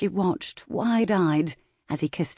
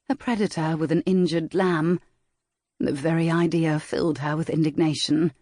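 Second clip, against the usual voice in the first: usual speaking voice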